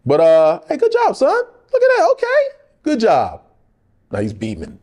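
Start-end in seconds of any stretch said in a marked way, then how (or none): 0.7-3.4 high, louder praising father; proud dad. Retold or imitated. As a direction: hype up your son.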